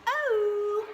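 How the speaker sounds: "Oh." said high as a long O; a bright high "Oh" as the letter name; long O sound. Shown as a demonstration. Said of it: "long sound where it says its name".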